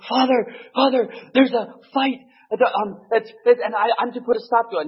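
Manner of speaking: high, breathless plea; urgent young priest. Retold or imitated. Young priest rushing to the fishing old priest, high urgent plea. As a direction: run up calling Father in alarm. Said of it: "he comes".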